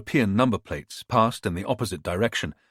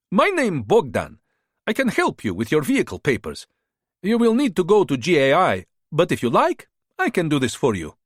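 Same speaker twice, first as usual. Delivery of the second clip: put-on higher-pitched, smooth-talking hustler with a foreign accent; charming border fixer; 6 semitones higher than usual. A narrator's reading. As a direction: sell yourself like a friendly tout. Bogdan's held voice.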